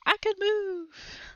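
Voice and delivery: high, slow, strange little voice; tiny creature voice. Acted. Harry freed from the spell, high slow happy creature voice. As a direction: squeak it out as a freed toy. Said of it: "wiggled his arms".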